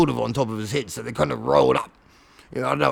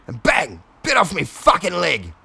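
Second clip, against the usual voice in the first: usual speaking voice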